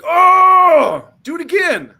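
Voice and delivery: long high howl, then strained plea; mock pained wail. Kicked man asking for another, high mock agonized cry.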